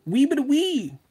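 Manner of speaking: "Wee bit of wee" squeaked very high and loud in mock English; hammed-up British accent. Showing off to a YouTuber, very high, loud mock British "Wee bit of wee". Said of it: "I'm bilingual".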